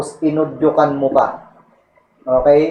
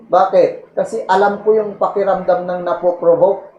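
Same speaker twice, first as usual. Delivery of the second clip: normal talking voice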